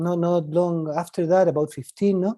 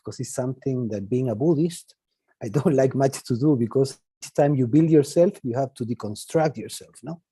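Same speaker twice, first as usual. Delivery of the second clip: usual speaking voice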